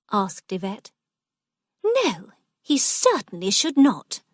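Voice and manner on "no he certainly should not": higher, firm mild protest; understated indignant insistence; cried out. A narrator's reading. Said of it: "cried Missus Eastwood".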